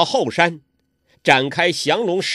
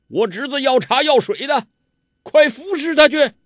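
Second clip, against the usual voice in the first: high, louder bullying villain voice; arrogant villain; 12 semitones higher, 5 dB louder than usual. A narrator's reading. Ouyang Feng ordering Huang Rong to tend his nephew, high bullying voice. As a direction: boss her around like a servant.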